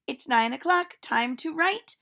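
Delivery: put-on a cheerful teacher calling out to children; silly teacher voice. Teacher speaks.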